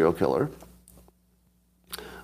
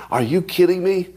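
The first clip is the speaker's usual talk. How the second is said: mock outrage; 8 semitones higher than usual.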